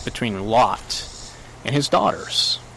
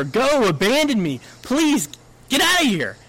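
high-pitched, urgent plea; pleading; 11 semitones higher than usual. Acted. Naomi begging Ruth to leave her, pleading. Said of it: "Naomi is pleading with her".

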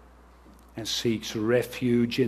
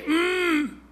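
high, pinched hum of pain; a small whimpering groan like a hurt child. Pretend. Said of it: "that's groaning".

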